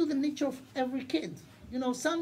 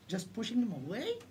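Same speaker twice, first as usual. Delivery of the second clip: ordinary talk, nothing marked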